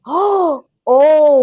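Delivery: breathless startled oh; sudden realization. Retold or imitated. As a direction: caught off guard by a revelation. Sudden realization after a gasp.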